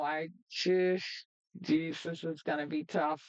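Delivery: usual speaking voice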